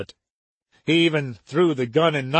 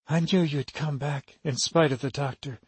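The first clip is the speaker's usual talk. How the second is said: put-on soft, husky voice of a sick girl; low husky voice; 6 dB quieter than usual. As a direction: speak softly with a husky edge. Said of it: "low, husky voice of Helen O'Malley".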